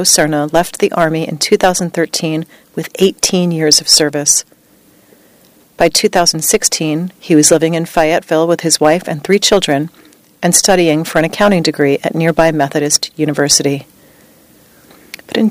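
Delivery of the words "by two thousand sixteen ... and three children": ordinary delivery, nothing marked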